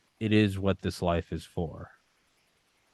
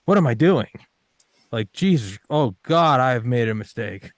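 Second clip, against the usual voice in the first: higher and louder frantic mock panic; mock terror; 6 semitones higher, 6 dB louder than usual. Applicant panicking over his med school gamble, higher, louder hammed-up panic. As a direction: spiral into hammed-up dread.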